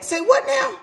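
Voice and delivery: very high shocked squeal; disbelieving high shriek; stunned disbelief. Retold or imitated. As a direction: hit it like the floor fell away. Shocked reply to confession.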